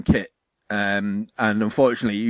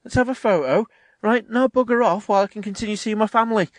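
higher-pitched offhand greeting, mocking; casual celebrity visitor; 9 semitones higher than usual. [name] meeting a kid in reality, mock casual. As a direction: breeze through a token fan meeting.

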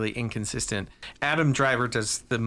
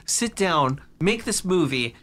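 ordinary delivery

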